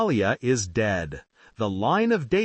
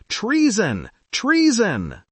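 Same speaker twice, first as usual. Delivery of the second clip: high-pitched cry, rising in force on the repeat; restrained dramatic outcry, pitched well up; narrated scream; 8 semitones higher, 4 dB louder, slower than usual. A narrator's reading. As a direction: cry it out in alarm, short of a full scream. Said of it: "tears her robes and screams".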